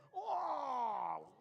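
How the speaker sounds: high, drawn-out falling "whoa"; hammed-up astonished cry; mock amazement. Magician amazed at his own trick, hammed-up high cry.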